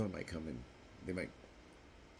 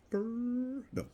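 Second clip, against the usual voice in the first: shrill no; 5 dB louder than usual. Acted. Mock outburst.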